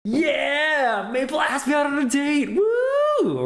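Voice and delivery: high-pitched cheering celebration; excited, happy. Shown as a demonstration. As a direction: cheer it, ending in a whoop. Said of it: "is the excited version of this".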